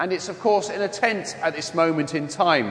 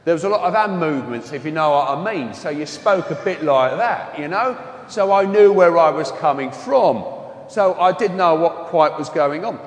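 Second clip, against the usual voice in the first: louder, broad Wembley London accent; 4 dB louder than usual. Shown as a demonstration. Priest showing how he once spoke, broad London accent. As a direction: put on your old broad London speech. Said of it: "a lot broader London accent".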